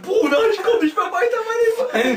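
very high, loud fake wail; mock despair. Imitating a friend stuck on maths, mock wailing. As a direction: whine as if life is over, hammed up. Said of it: "Immer voll am Verzweifeln".

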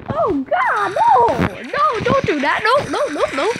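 high loud panicked pleading. Real, not acted. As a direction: beg in rising panic. Panicking in game.

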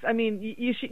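ordinary talk

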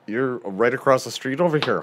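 ordinary delivery

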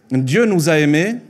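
ordinary delivery

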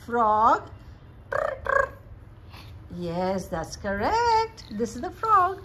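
drawn-out, high-pitched encouragement. Real, not acted. Mother quizzing her baby on pictures, playful baby talk.

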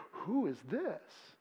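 slow, breathless, barely voiced wonder; hushed awed whisper. Shown as a demonstration. Preacher showing the crowd's hushed amazement. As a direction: breathe it out slowly in awe. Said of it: "You could say".

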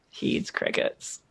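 low, unvoiced whisper; whispered aside. Real, not acted. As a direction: breathe it as a secret. Muttered aside.

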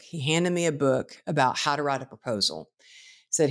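normal talking voice

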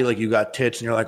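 ordinary talk

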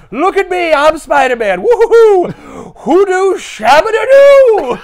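high, loud goofy whooping yell; Adam Sandler impression. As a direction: go full dopey Sandler, whooping. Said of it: "Yep, that's Adam Sandler".

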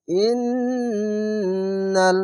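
slow, long-drawn chanted syllables; tajweed recitation. Shown as a demonstration. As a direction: stretch every sound in recitation. Teacher recites the phrase.

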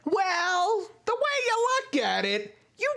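piercingly high mock sitcom character; obnoxious character voice. One annoying character in a comedy show, obnoxious high voice. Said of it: "this one character just".

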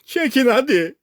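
high-pitched yelled command; urgent shout. A narrator's reading. Castaway tied to the rope urges crew, urgent shout. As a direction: cry out to be hauled in. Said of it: "diye bağırdım".

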